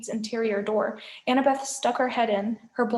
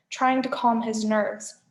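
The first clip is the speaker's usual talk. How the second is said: usual speaking voice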